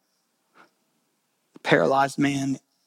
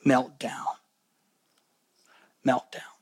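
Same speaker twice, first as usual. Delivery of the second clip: hushed meltdown; 4 semitones lower, 6 dB quieter than usual. Shown as a demonstration. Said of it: "quiet dramatic repeated word".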